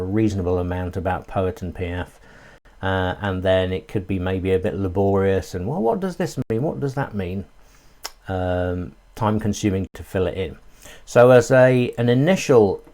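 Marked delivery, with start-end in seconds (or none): 5.7-7.4 mock puzzled user. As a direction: voice someone baffled by the form. Voices a puzzled spreadsheet user.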